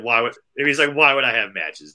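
loud mock disbelief; incredulous clerk. Desk clerk answering a silly request, loudly incredulous. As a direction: scoff loudly as the baffled clerk.

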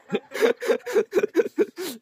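squealing laugh, near tears; helpless high laughter. Real, not acted. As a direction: laugh till you cry. Botches the cracker joke.

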